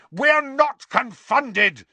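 high, slow, loud roar. A narrator's reading. Boy denying he is confounded, roaring. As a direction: roar it in indignation. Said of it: "Harry roared".

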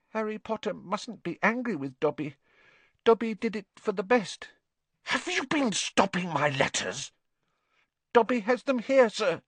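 high, anxious house-elf voice; a fretful little elf pleading. Acted. Dobby's elf voice.

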